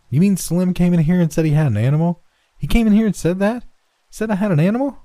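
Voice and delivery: put-on high, incredulous boy's outcry; indignant. A narrator's reading. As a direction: protest in outraged, rising questions. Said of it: "Red recovered indignant breath".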